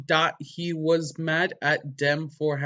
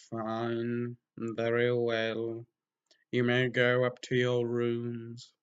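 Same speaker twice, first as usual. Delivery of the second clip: Snape relenting; 2 semitones lower, 4 dB quieter, much slower than usual. Acted.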